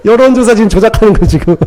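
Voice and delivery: amused laugh running into the words. Real, not acted. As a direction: crack up while talking. Preacher laughs at his own joke.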